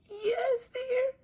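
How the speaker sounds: wolf faking a frail granny; scratchy voice. Acted. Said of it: "came a scratchy voice".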